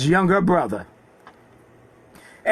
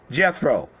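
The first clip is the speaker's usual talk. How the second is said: normal talking voice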